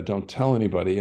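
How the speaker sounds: ordinary talk, nothing marked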